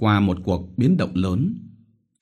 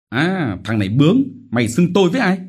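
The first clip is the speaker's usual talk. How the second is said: outraged yell, higher and louder; enraged shout; 9 semitones higher, 4 dB louder, faster than usual. Acted. Chief enraged at Quý's rudeness, outraged shout. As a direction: explode at his insolence.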